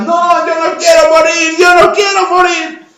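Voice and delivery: high, loud panicked cry; hammed-up wail of despair; desperate screaming. Second frog faces death in the milk, desperate screaming. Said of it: "empieza a gritar desesperada".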